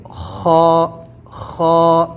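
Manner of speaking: "خا" scraped from the throat against the palate; long, throaty "خا"; throaty letter demo. Shown as a demonstration. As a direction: teach "خا" with force from the throat. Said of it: "حلق سے ہی نکالیں گے".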